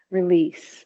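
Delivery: ordinary talk, nothing marked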